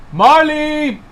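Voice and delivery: high, loud singsong call; calling the dog. Real, not acted. Owner calling birthday dog Marley, calling out. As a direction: call a pet from across the room.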